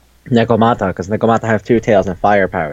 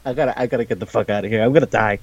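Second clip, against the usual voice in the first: fast, softer panicked babble; mock panic; 4 dB quieter, much faster than usual. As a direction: scramble to get away. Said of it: "Sukune just freaks the fuck out".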